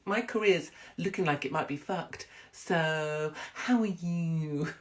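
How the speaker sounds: slow, drawn-out gushing presenter voice; syrupy daytime-telly sweetness; Holly impression. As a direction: coo it slowly like a TV host. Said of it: "give us a Holly impression".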